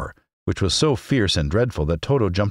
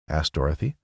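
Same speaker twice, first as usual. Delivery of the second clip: plain reading voice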